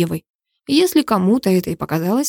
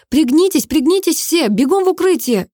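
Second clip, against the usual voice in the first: higher-pitched urgent call to everyone; urgent warning; 8 semitones higher than usual. A narrator's reading. Officer warns the crowd to duck and run for cover, urgently.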